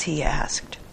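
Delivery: usual speaking voice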